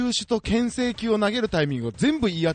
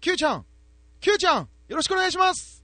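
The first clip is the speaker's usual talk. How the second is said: put-on high calling old coach voice; Koide impression; 14 semitones higher, 3 dB louder than usual. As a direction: cheer like a marathon coach from the roadside. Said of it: "小出義雄監督のモノマネですか".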